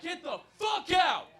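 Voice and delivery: high, slow shouted dismissal; angry shout. Retold or imitated. Rapper throws fake accountant out, angry shout. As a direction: throw him out with a big yell. [name] throws him out.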